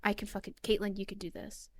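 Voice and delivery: hushed, talking herself into it; quiet self pep talk. Retold or imitated. Streamer hyping herself up, under her breath. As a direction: softly psych yourself up before a game.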